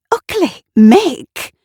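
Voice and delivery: high, loud scolding squeak; high mock-shocked reprimand; mock outrage. A narrator's reading. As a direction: playfully tell him off. Tanya scolds Mick's rudeness.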